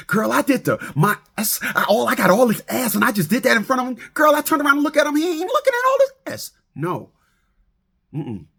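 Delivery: put-on fast, rising sassy girlfriend voice; mock girlfriend chatter, high at the end; gossiping woman. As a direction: gossip to your girl, climbing high. Voicing a woman talking to her friend.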